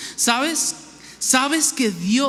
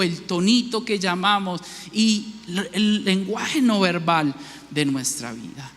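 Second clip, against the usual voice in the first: ordinary talk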